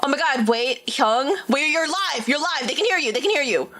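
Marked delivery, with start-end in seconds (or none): none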